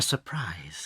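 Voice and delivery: secretive unvoiced whisper. Father fox teasing the children with a secret.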